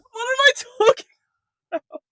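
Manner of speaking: high, loud squeal breaking into laughter; laughing at oneself. Real, not acted. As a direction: crack up at your own rambling. Cracks up at her own rambling.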